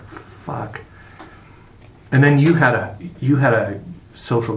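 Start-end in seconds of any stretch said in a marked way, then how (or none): 0.0-0.8 whispered curse. Retold or imitated. Realizes friend is dead.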